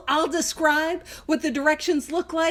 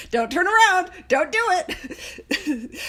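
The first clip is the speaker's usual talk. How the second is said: raised, playful mock warning breaking into laughter; joking warning; 5 semitones higher, slower than usual. Speaker to listeners about a do-not-enter sign, joking warning. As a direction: jokingly call out a warning, then slow down laughing.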